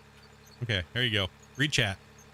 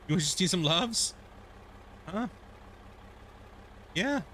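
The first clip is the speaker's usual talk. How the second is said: lightly raised cooing question; pet talk; 6 semitones higher, 5 dB quieter than usual. Real, not acted. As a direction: coo gently at the cat. Asking the cat.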